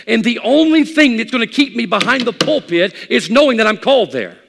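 loud, high pulpit voice; full-force preacher; fiery preaching. Acted. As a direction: drive it home with conviction.

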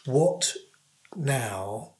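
ordinary talk

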